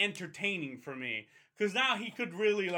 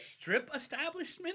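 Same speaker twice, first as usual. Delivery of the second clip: incredulous echo; 6 semitones higher, 5 dB quieter than usual. Real, not acted. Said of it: "The, the fuck?".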